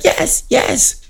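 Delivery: high whispered yes yes; breathless squeak. Retold or imitated. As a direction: gasp out an excited yes.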